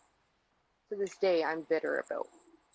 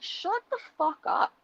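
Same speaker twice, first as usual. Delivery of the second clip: much higher, sharp burst of fed-up anger; exasperated snap; 10 semitones higher, 3 dB louder than usual. Retold or imitated. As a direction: bite it off like your patience just broke. Venting at mother's interruption.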